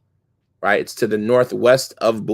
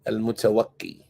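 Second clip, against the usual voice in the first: quiet; 2 semitones lower, 7 dB quieter than usual. Real, not acted. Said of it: "How would you translate that".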